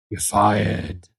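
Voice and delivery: low mock-boss voice; Nuggets. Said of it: "as Nuggets is laughing to himself".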